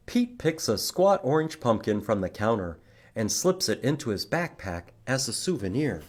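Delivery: ordinary talk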